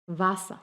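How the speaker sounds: "Wasser" ending in an open A; vocalized final R. Shown as a demonstration. Teacher demonstrating "Wasser" with final R as A. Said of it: "suena como una A al final".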